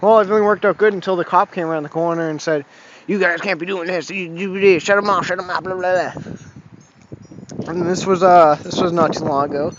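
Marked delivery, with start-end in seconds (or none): 3.1-6.3 lightly mimicked cop, rattling off orders into gibberish; mildly mocking retelling of an officer's blathered orders. Cop telling riders to shut off tractors, lightly mocked.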